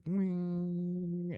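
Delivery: high, held ringing tone; steady high-pitched whine; imitated ear ringing. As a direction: mimic ringing ears on one high note. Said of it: "my ears started ringing, real, like".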